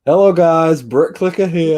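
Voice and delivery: loud, high mimicked YouTuber accent; Brick Clicker's accent. Showing co-host a fellow YouTuber's intro, loud imitated accent. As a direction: belt out an imitated accent for a video greeting. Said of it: "I can't do Brick Clicker's accent".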